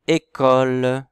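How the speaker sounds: École called out loud with a crisp é; clear demo word. Shown as a demonstration. Teacher to class, naming the picture loudly and clearly. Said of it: "Est-ce qu'on entend le et?".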